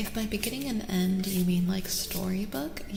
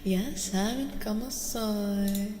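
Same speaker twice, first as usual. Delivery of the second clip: slow repeat; slower than usual. Real, not acted. Streamer telling viewers they know her, slow drawn-out repeat. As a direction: draw out "Ya saben cómo soy".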